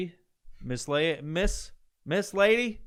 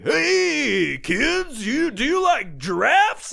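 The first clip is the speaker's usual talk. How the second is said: much higher, louder, slow hyped pitch voice; weird salesman voice; 12 semitones higher, 8 dB louder, much slower than usual. Retold or imitated. Car salesman DJ calling to kids, high loud hyped voice. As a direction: sell it to kids like a car-lot DJ. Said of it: "this weird fucking voice that they all do".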